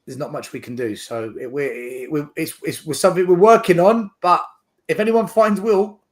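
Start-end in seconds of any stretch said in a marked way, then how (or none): 2.9-6.0 raised voice. Real, not acted. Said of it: "appeal to find Will".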